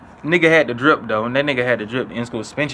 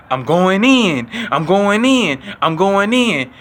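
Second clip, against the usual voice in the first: raised voice, slow and chanting "I'm going in"; classmate hyped up; 5 semitones higher, much slower than usual. Retold or imitated. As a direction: psych yourself up for a fight, a little higher. Classmate hyping himself to fight.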